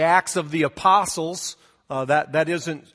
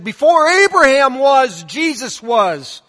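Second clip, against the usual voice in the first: rising preacher's proclamation, higher and louder, slow on the end; more excited preacher; 6 semitones higher, 6 dB louder, slower than usual. Retold or imitated. Preacher answers the old lady, building fervor. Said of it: "got a little bit more excited".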